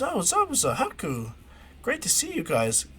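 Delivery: put-on high, swooping hello to old friends; excited greeting. Naruto greets Zabuza, Haku and Gramps, excited. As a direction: greet them eagerly, pitch leaping up.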